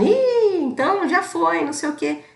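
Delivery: high, offhand "oh well, it's done" tone; dismissive brush-off. Retold or imitated. Telling her relatives on the phone, dismissively offhand. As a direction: toss it off like it no longer matters.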